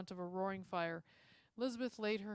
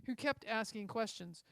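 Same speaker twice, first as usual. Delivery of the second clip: usual speaking voice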